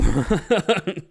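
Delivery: loud high laugh bursting out; sudden loud laugh; burst of laughter. Real, not acted. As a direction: laugh it off loudly. Laughing at gun question.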